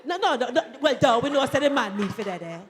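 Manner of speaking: ordinary delivery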